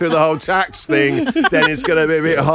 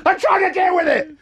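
shouting back. Retold or imitated. Husband shouting back to his wife, high and exasperated. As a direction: yell it back, fed up.

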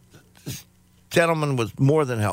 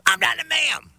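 high mocking clerk voice; 12 semitones higher than usual. Clerk correcting the customer, high and indignant. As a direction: squeak it out like the offended clerk. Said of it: "Thank you, ma'am".

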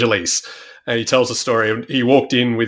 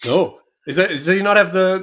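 ordinary talk

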